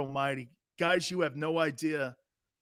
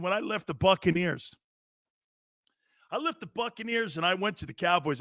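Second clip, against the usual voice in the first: usual speaking voice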